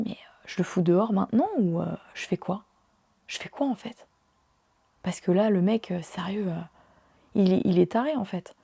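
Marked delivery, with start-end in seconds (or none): 0.1-4.1 whispered, softer, panicked inner voice; inner-thought whisper. Retold or imitated. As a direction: whisper it like thinking aloud in shock. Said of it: "je me dis".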